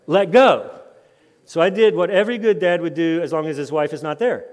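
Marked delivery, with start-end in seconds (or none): 0.1-0.7 loud, higher mock kid's demand; insistent small child. Son to dad after being reasoned with, loudly insistent. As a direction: imitate a stubborn child calling out.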